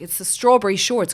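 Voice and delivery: loud, high burst of realisation; sudden realisation. Retold or imitated. Mother to daughter, loud realisation. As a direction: blurt it out as it suddenly clicks. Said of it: "I was like".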